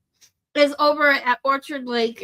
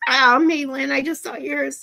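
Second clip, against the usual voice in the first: normal talking voice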